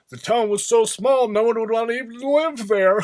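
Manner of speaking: loud hammed-up radio host with cackle; mocking talk radio DJ. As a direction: ham up a DJ cracking himself up. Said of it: "exactly like that".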